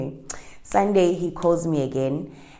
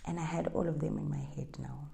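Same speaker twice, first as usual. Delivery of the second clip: whisper; 13 dB quieter, faster than usual. Real, not acted. Private thought aside.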